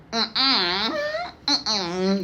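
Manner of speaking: laughing mm-mm. Real, not acted. Laughing refusal of complaining.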